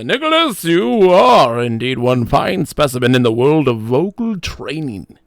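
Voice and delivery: loud, swooping pompous voice; lavish praise with hammed-up pomp; mock grandiose. Co-host praising Nicholas's vocal training, mock grandiose.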